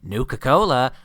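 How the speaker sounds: loud mock-excited squeal of the brand; gushing superfan. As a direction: blurt it like an obsessed fan. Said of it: "does not stop talking about Nuka-Cola".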